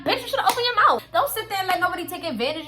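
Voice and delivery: high, loud, rapid telling-off; fired-up, fast rebuke; exasperated scolding. Retold or imitated. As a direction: scold her for staying silent. Scolding the absent girl.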